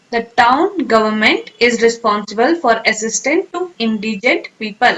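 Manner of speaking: very slow, word by word; slow teacher dictation. Shown as a demonstration. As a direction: read it slowly for students to repeat. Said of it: "sentence repeat करें मेरे पास".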